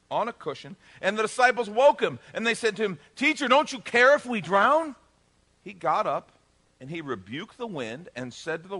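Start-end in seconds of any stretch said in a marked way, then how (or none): 3.1-4.9 raised, loud anxious question; higher, louder, rising appeal; alarmed plea. Acted. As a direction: plead urgently as if about to go under. Disciples waking Jesus in storm.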